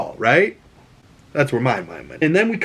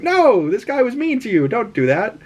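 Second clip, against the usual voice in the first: high, sing-song voice for a pet; talking to a dog; 11 semitones higher than usual. Retold or imitated. Talking to the sad dog, high pet-talk voice. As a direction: coo at the dog like a baby.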